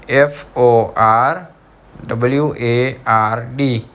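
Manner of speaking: slow letter by letter; spelling out letters. Shown as a demonstration. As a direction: spell it slowly for the class. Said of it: "Number third is".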